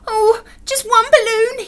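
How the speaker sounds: high, loud wail of a little bear; pleading cry. A narrator's reading. Alan spotting balloons on the way, pleading cry. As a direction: beg in a whiny high cry. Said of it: "he cried".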